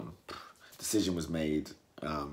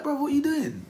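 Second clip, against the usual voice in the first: exasperated opponent; 6 dB louder than usual. Retold or imitated. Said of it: "He was like".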